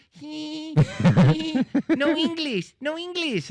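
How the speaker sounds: put-on titter, then "no English" in a high Indonesian accent; mock Indonesian girl. Imitating Indonesian girl on stream.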